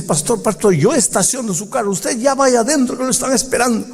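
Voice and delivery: put-on old man at the door hurrying the pastor in; urgent old usher. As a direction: rush the pastor inside, lightly voiced. Old usher urging late pastor.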